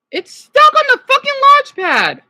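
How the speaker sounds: high, loud exasperated shout; frustrated yell. Real, not acted. Streamer shouting at his MacBook stuck on the launch pad, fed up. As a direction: blow up at the stuck laptop.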